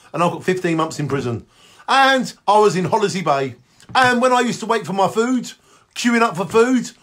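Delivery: normal talking voice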